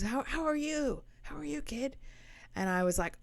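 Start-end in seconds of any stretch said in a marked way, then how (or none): 0.0-2.0 put-on higher, sing-song old dad voice; lightly voiced happy old dad; imitating cheerful dad. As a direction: play the delighted father on the phone. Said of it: "he goes".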